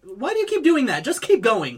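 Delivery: loud, high exasperated outburst. Real, not acted. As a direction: scold a fumbling friend at full voice. Exasperated at friend fumbling for name.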